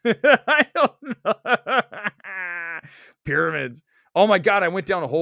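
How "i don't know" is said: laughing through "I don't know"; real laughter. Real, not acted. Streamer laughing at his own two-suns theory. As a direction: crack up mid-sentence.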